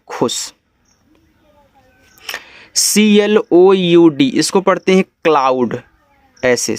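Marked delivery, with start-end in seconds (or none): 2.8-4.3 slow, higher letter-by-letter spelling. Shown as a demonstration. Teacher starting a new word for learners, slow spelling out. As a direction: call out each letter slowly.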